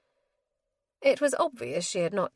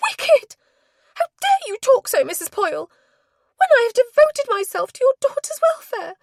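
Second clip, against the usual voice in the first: very high, loud, fast shriek of outrage; 12 dB louder than usual. A narrator's reading. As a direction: shriek it, beside herself. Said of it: "Nancy's voice rose to a shriek".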